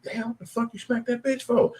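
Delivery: high, startled scolding; shocked friend. Retold or imitated. Friend at the club after the slap, high shocked scold. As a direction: squeak out your shock.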